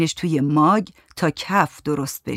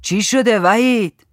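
raised, higher, drawn-out shout; calling out; 5 semitones higher, 5 dB louder, slower than usual. A narrator's reading. Grandpa shouting to ask what happened, drawn-out call. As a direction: call out slowly to someone across the house. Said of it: "باباجی داد زد".

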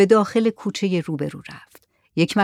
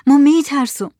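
higher, more desperate repeat of the fear; trembling fearful cry; 7 semitones higher, 6 dB louder than usual. A narrator's reading. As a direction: let the fear break out on the repeat. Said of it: "با صدای لرزان گفت".

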